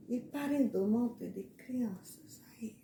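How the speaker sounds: hushed, secretive whisper; whispered aside. Acted. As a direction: whisper it like gossip. Gossipy aside about Ronaldo. (cochicho de fofoca)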